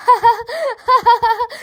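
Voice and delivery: high amused burst of laughter; big teasing laugh. Acted. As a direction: laugh off the child's fear. Didi laughs at the ghost story.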